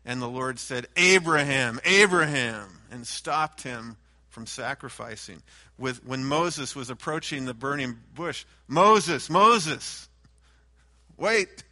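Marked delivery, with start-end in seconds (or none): 1.0-2.8 loud urgent repeated name; urgent loud call. Acted. The Lord stopping Abraham mid-sacrifice, loud urgent call. 8.7-11.6 loud urgent warning, rising on wait; loud warning call. Acted. The Lord halting Moses at the burning bush, loud warning call.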